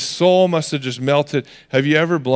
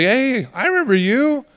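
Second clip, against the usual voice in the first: put-on higher-pitched voice of a little girl; sweet little girl; 7 semitones higher than usual. Girl recognizing Peter by the fire, high little-girl voice. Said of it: "this sweet little girl".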